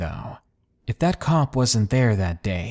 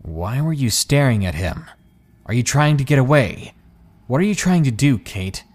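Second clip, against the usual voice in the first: angry; 3 semitones higher, 4 dB louder than usual. Acted. Possessive boyfriend questioning his date as he grips her hand, angry. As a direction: demand answers angrily. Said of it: "speaking angrily".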